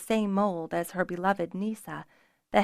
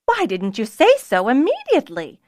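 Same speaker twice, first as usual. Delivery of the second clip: louder, flustered exclamation; exclaiming; 5 semitones higher, 7 dB louder than usual. A narrator's reading. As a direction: blurt it out in a fluster. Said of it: "as she exclaimed".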